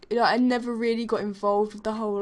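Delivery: normal talking voice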